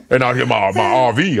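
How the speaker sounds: low mock country Southern voice; Southern accent. Teasing friend about fishing and RV, low Southern accent. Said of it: "You so Southern".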